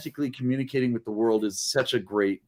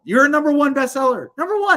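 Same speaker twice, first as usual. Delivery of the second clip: much higher and louder, celebrating; excited cheer; 11 semitones higher, 5 dB louder than usual. Real, not acted. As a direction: cheer the news like a big win. Host celebrating guest's bestseller.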